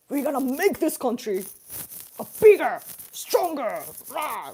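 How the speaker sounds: loud, forceful mock campaign speech; Japanese politician. Vlogger imitating a Japanese politician's stiff gestures, mock forceful speech. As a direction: punch each phrase like a katana swing. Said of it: "Japanese politicians will be like".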